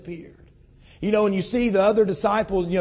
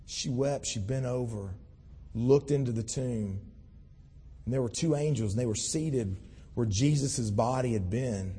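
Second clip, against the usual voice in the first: normal talking voice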